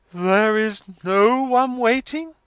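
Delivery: slow, high, loud and majestic; majestic accents. A narrator's reading. Missus Wilfer repeats her daughter's words, majestic disbelief. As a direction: repeat it with grand, drawn-out majesty. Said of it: "in majestic accents".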